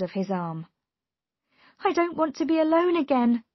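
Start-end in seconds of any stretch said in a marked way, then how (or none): 1.8-3.4 high, loud, anxious plea; urgent plea. A narrator's reading. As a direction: blurt it out, pitched high. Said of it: "she said quickly".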